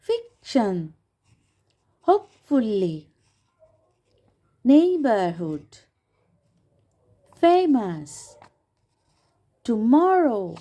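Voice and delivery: Fiction on "ফিক", hopefully on "হোপ", neighbourhood slow on "নেই", famous on "ফে", tomorrow on "মরো"; one word at a time, punching each bold syllable; stressed syllables. Shown as a demonstration. Teacher modelling word stress for students to copy, syllables punched. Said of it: "ঠিক সেরকম তোমরাও চেষ্টা করো".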